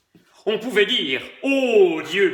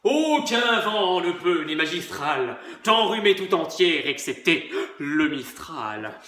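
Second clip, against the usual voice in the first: emphatic. Acted. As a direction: weigh every word pompously. (emphatique et lent)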